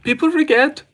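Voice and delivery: very high falsetto attempt at an impression; put-on high voice, retrying the line; catchphrase impression. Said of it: "I've embarrassed myself there".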